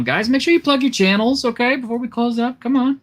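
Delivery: high-pitched wheedling; high pleading call. Real, not acted. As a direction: coax them in a high voice. Host calling on panelists.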